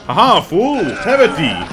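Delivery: high, loud villainous taunt; stagey fake archaic declaiming. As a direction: gloat like a hammy knight. Taunting enemies in combat.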